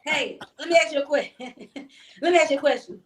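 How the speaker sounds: can't hold the giggle while asking; laughing. Real, not acted. Guest to host, asking a question while laughing.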